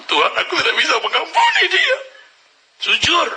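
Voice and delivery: raw, lifted outburst of a confession; anguished cry to God. Acted. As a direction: cry out that you cannot forgive. Angry confession in prayer.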